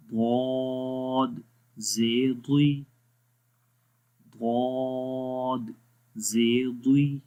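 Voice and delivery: each ضاد held very long, then ضی or ضو said short; ضاد drawn out heavily, vowel syllable clipped after; slow spelled recitation. Shown as a demonstration. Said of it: "اب اس کی مثالیں پڑھتے ہیں". (ضاد کو کھینچ کر اور ضی ضو کو مختصر پڑھیں)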